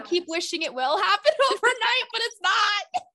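high-pitched, laughing through the words; laughing exasperation. Real, not acted. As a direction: let the laugh push your voice up. Joking confession with laughter.

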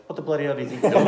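fast, laughing mock scolding; imitating Dad's telling-off. Father finding his son stuck in the creek, laughing mock scolding. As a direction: rattle off Dad's rebuke while laughing. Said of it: "He goes".

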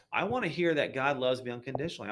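ordinary talk, nothing marked

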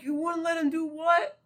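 very high-pitched questioning; incredulous, voice shooting way up; baffled disbelief. Acted. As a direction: demand an answer in stunned confusion. Acting own baffled reaction to line.

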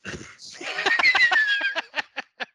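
very high, loud manic cackle; hammed-up high-pitched impersonator's laugh; Tom Cruise laugh. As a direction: explode into an over-the-top, drawn-out laugh. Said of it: "my terrible Tom Cruise impersonation".

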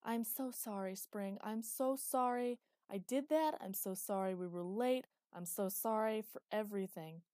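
mild tearful apology. A narrator's reading. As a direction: pour out apologies. Friend apologizing in a hug.

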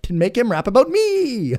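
very high, squeaky inner voice; mock eager fan. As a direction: imitate an attention-hungry brain in falsetto. Acting out the audience's inner voice.

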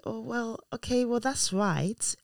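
hesitant, high and softened; doubtful mother. Retold or imitated. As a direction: stretch it out, unsure, as if about to refuse. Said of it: "the tone of my voice".

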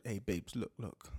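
put-on low, soft sweet-talk to a girlfriend; hushed, low pleading; sweet-talking boyfriend. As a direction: drop low and quiet to win her back. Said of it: "then that man says to her".

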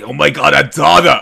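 loud raspy smoker mom voice. Pretend. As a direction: bark it like a chain-smoking mom. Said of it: "the smoker voice".

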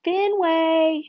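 high, sing-out hopeful call; hopeful, lifted calling voice. A narrator's reading. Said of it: "she calls in a hopeful voice".

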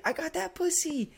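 high-pitched mock triumph; imitated boasting. Crazy friend bragging at the club, high mock elation.

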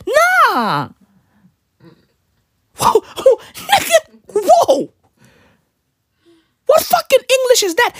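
very high, squeaky astonishment; comic high-pitched bafflement; incredulous disbelief. Real, not acted. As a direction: react appalled, voice leaping way up. Reacting in disbelief to mimicked English.

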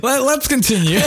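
high, helpless laughing speech. Real, not acted. Co-host trying to move on after cracking up, laughing. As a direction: try to carry on through laughter.

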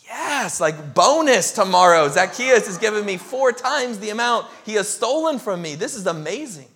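high, loud jubilant cheer; gleeful crowd. Retold or imitated. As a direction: celebrate a windfall out loud. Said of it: "the crowd is like".

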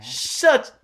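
sudden high yell; shout. Real, not acted. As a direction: burst out yelling. Yelling at friends.

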